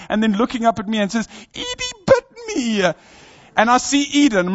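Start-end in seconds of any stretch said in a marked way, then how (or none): 1.5-2.9 put-on high, slow, tearful little-girl voice. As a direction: imitate a hurt child telling on her sister. Child's complaint voiced in story.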